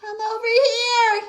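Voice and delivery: high, loud yell. Acted. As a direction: shout over the blinding rain. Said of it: "he yelled".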